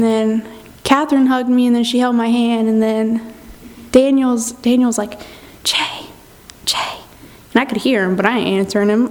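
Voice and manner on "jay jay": softly hiss-free, hushed name-calling. Retold or imitated. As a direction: imitate a whispered call for attention. Retold friend calling during prayer.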